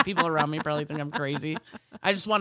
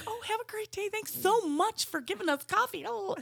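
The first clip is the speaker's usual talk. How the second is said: fake sweet thanks. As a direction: gush thanks you do not mean, high and light.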